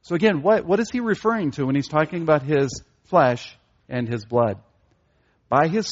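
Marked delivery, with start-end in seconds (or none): none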